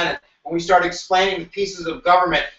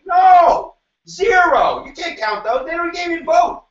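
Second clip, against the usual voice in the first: North's indignant refusal; 10 semitones higher, slower than usual. Acted. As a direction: shout a hammed-up refusal as the North. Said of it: "The North is saying".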